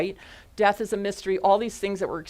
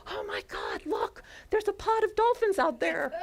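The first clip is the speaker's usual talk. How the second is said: very high, squealed excitement; imitated screaming girls; 13 semitones higher than usual. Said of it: "these two girls started screaming".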